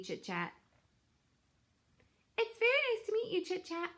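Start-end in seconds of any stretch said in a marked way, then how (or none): 2.4-3.9 put-on much higher, small young gosling; high, bright gosling voice. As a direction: squeak it out like a shy little gosling. Gosling's greeting, high retake.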